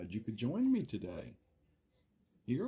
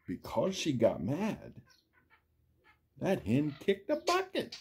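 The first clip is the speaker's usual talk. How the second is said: lively, sing-song storybook read; reading to children. Acted. Reading a picture book aloud to young viewers, sing-song. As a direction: build each chain link like a bedtime story.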